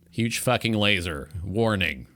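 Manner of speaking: usual speaking voice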